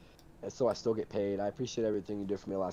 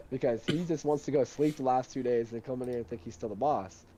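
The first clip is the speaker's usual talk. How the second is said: ordinary delivery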